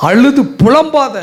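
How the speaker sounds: high pleading. Acted. God comforting a weeping mother.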